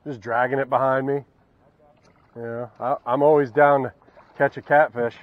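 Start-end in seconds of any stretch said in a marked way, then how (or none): none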